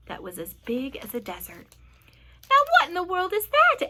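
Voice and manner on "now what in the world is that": loud, high-pitched wonder; raised voice, baffled and amazed; astonished question. A narrator's reading. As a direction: ask it in amazement. James reacts to extraordinary sight.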